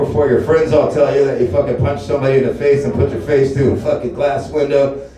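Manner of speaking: normal talking voice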